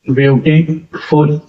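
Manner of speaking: Beautiful slowly, with a small break between beauty and फुल; said in two syllables. Shown as a demonstration. Teacher shows students the two syllables of a word, slow with a break. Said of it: "word में एक break आ रहा है".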